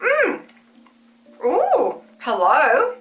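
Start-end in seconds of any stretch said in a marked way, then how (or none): none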